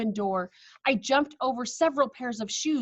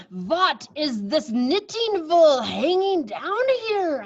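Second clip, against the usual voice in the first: slow, loud, higher witch voice; suspicious witch; 7 semitones higher, 6 dB louder, much slower than usual. Acted. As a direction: drag it out, suspicious and menacing.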